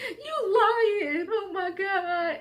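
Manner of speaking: high, loud crying disbelief; high crying, slow at the end; crying sister. Retold or imitated. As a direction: sister sobbing out her shock.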